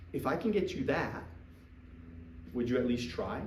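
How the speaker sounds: low, soft, calm ask; not excited. Shown as a demonstration. Coach showing a recruit how to ask calmly, soft and low. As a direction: ask it softly, no hype. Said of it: "then don't get excited, just say".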